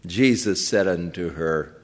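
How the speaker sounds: usual speaking voice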